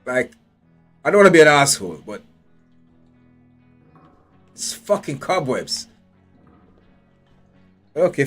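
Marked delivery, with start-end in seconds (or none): none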